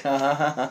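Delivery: acted "ha, ha, ha" of scorn; mocking laugh. Angels jeering at God's regret, mocking laugh. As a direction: voice a fake, spaced-out laugh. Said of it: "And they're like".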